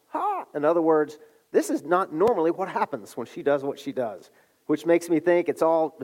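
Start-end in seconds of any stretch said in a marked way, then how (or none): 0.1-0.4 a sudden high yelp of shock; witch's startled cry. Acted. Medium sees a spirit rise, startled high cry. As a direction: gasp it out sharply, way up high. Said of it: "she cries out".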